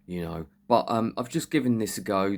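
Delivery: usual speaking voice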